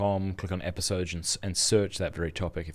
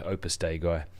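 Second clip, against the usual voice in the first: ordinary talk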